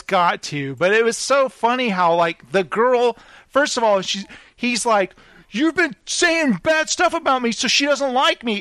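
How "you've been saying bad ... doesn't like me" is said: high-pitched mock complaint; whiny accusation. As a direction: imitate him blaming her, pitched up. Retold accusation in the quarrel.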